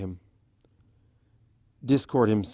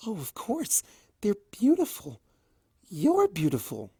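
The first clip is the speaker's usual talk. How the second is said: gentle pony voice, higher and amused; higher voiced Fluttershy; 9 semitones higher than usual. A narrator's reading. Fluttershy laughing at Discord's pogo trick, higher and sweet. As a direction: lift it up, sweet and charmed.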